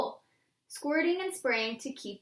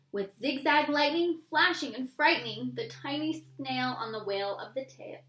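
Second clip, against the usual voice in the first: plain reading voice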